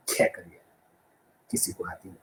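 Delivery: soft, low, almost breathless reply; whispered mumble. Acted. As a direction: mumble it away in defeat.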